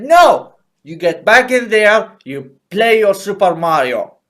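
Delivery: loud, high-pitched scolding dad; Thatcher's father. Acted. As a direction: shout it like a strict father giving orders. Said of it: "this is me being Thatcher's father".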